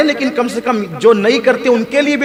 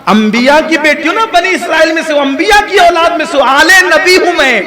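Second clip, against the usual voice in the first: proud declaration; 6 semitones higher, 4 dB louder than usual. Retold or imitated. Proud reply taught by the Prophet.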